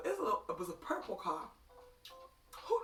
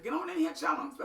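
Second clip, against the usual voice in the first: Grandma calling; 6 semitones higher, 6 dB louder than usual. Retold or imitated. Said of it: "Grandma said".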